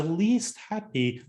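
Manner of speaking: "least happy" stretched slowly and pitched high; emphatic stress. Real, not acted. To the audience about Moldova's survey ranking, slow emphatic stress on "least happy". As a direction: lean hard on "least happy".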